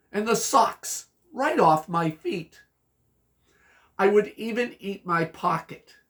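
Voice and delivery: lively, punchy verse reading; rhyme recitation. Acted. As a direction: recite a silly rhyme to children with bouncing stress.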